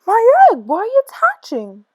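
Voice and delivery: high and loud, easing off at the end; excited shout. Acted. Horton as the egg hatches, excited shout. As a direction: burst out then marvel. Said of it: "shouted Horton".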